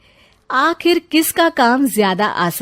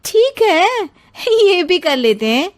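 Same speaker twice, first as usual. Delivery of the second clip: defiant; 8 semitones higher than usual. Acted. Bahu accepts challenge defiantly.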